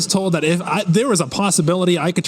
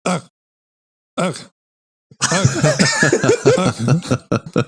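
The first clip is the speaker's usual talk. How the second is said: villain laugh grunts. Pretend. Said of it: "the peanut butter baby grunt".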